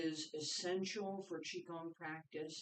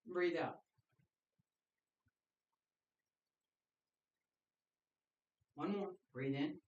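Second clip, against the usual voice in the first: calm breathing cues. Acted. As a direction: count the class through each breath. Leads paced breathing cues.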